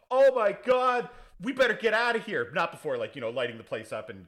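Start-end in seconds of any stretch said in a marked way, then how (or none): 0.1-2.5 much higher, louder panic; hammed-up scared yelp; mock panicked thugs. Yakuza thugs flee the fight, high and loud. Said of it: "who are like".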